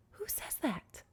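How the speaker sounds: high, soft incredulous question; hushed disbelief. Acted. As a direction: ask it in a small, appalled voice. Repeats appalled question more quietly.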